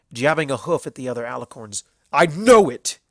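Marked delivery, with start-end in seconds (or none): 2.1-3.0 much louder, higher stallion voice; shouting. A narrator's reading. Everfree accusing the alicorns, shouting. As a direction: fling the accusation hard. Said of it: "he shouted".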